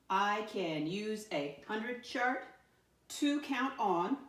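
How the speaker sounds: slow, drawn-out dictation; slow dictating voice. Shown as a demonstration. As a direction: say it slowly as you write. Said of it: "writing the statement slowly".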